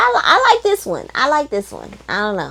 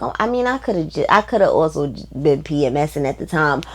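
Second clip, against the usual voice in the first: muttered aside; 4 semitones lower, 7 dB quieter, much faster than usual. Retold or imitated. Quick self-mocking aside.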